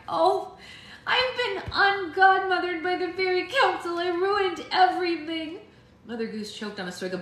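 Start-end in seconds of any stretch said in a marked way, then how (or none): 0.1-5.6 put-on higher, near-tears young girl; fighting off crying as a girl; holding back tears. A narrator's reading. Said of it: "willing herself not to cry".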